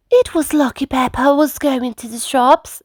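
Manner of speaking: high storybook mum; Mummy Sheep. A narrator's reading. Neighbour mum receiving shopping, high chatty voice. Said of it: "said Mummy Sheep".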